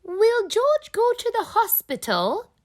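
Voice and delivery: put-on high, bright child asking worriedly; a small piglet asking in worry; little girl voice. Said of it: "asks Peppa".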